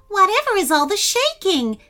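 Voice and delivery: high worried squeak; anxious little bat voice. A narrator's reading. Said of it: "asked a worried Bitsy".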